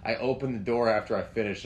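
normal talking voice

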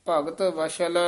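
ordinary delivery

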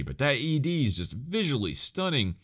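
ordinary talk, nothing marked

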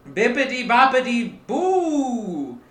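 high, slow spell-casting voice; witch voice. Acted. The witch casting her spell, high and slow.

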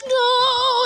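high, drawn-out wailing no; mock crying denial. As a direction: imitate a drunk guy crying in protest. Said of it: "he's, like, crying and all".

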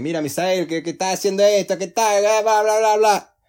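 loud, high-pitched hectoring boss rant; mock shouting. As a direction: imitate a boss yelling, building into loud blah-blah. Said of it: "puede llegar y gritarte".

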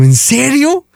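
high-pitched, incredulous reenacted reaction; stunned "really?" in a squeaky high voice; astonished. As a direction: relive the disbelief, voice leaping way up. Acted astonished reply in story.

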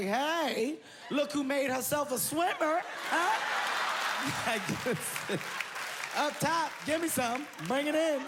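high, bright-voiced forced cheer; greeting the wife he abandoned, overly cheery; fake cheerful. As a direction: cover the awkwardness with mock cheer.

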